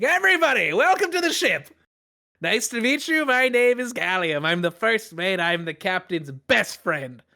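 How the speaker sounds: big cheesy smile in a high greeting voice; cheery first mate. Acted. First mate welcomes everybody aboard, high and cheery. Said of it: "big old cheesy smile".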